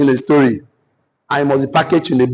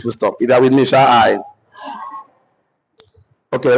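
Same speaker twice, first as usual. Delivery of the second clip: ordinary talk, nothing marked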